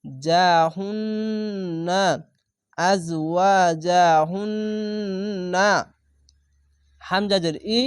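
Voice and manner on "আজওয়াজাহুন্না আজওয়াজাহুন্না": very slow আজওয়াজাহুন্না, long nasal hum on the double ন; obligatory ghunna. Shown as a demonstration. Teacher reciting the joined word with wajib ghunna, drawn-out hum. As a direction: stretch আজওয়াজাহুন্না and hold the humming n. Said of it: "এখানে ওয়াজিব গুন্না হবে".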